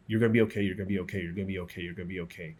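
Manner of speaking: steady reassurance fading to near silence; reassuring medic. Retold or imitated. Medic Liam reassures the wounded narrator, fading to quiet.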